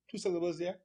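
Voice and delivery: normal talking voice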